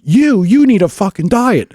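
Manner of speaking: a hint of Brian snapping at the heckler; retold insult. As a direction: fire back an insult like a hammed-up Brian. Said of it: "Brian looks up and he goes".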